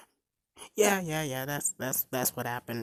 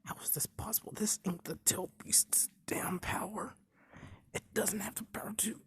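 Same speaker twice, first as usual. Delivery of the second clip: whispering; 7 semitones higher, 8 dB quieter than usual. Retold or imitated. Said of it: "Mizuki's thinking".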